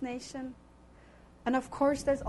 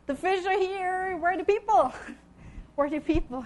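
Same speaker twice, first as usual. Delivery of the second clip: higher, louder pleading cry to God; pleading prayer; 6 semitones higher, 4 dB louder than usual. Retold or imitated. Missionary cries out to God for workers, high pleading. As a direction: beg heaven for helpers. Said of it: "I said".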